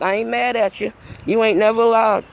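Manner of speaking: usual speaking voice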